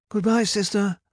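high singing-out farewell call. A narrator's reading. Medea flying off from Rapunzel, calling out. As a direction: call it out to someone left below. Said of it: "Medea called out to Rapunzel".

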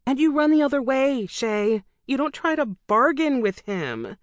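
high, urgent, near tears; upset scolding. Acted. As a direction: plead with her to be careful. Tearful friend scolds after danger.

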